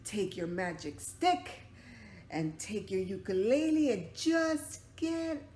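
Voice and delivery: loud high angry shout; angry crowd. Acted. Townsfolk shouting the father and son away. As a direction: chase them out of town. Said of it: "everybody got together and said".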